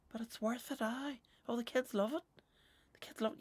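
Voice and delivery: high-pitched mock doting parent; Barbara, Halloween fan. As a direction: pitch it right up, mimicking a decorations lover. Mimics Barbara defending Halloween decorations.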